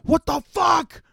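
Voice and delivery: high, slow indignant yell; mock angry shout. Shoved clubgoer turning on the narrator, mock angry yell. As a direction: bark it like a furious shoved guy.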